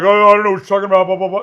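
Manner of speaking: fast, loud mock denial; dismissive impression of playing stupid; playing dumb. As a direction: imitate someone feigning cluelessness, rattled off quickly. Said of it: "he's trying to play stupid".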